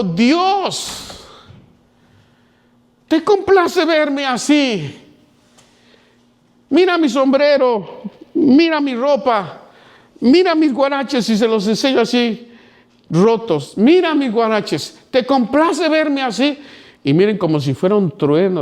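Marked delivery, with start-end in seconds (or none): 0.0-11.3 high, slow, anguished shout upward; angry cry to God. Retold or imitated. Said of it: "con enfado en mi corazón". 13.8-16.6 high, slow, anguished shout upward; angry cry to God. Retold or imitated. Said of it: "con enfado en mi corazón".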